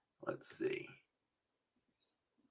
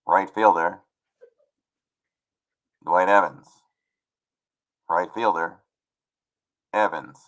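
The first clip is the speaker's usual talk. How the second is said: spaced-out ballpark lineup call; stadium announcer; 5 dB louder than usual. Shown as a demonstration. Announcer introducing Dwight Evans to the park, stadium PA voice. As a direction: announce the player, each phrase on its own. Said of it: "And then I would say".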